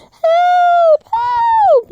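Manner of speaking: very high, loud screams; terrified girl screaming help twice; shouting for help. Acted. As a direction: scream for help while chased.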